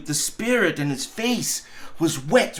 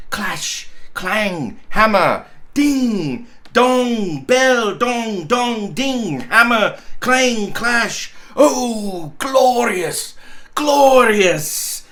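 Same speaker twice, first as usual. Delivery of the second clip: each bell word struck higher and louder; bells evoked; 5 semitones higher, 4 dB louder, much slower than usual. A narrator's reading. Narrator ringing out the church peals, rising. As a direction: ring out the bell words.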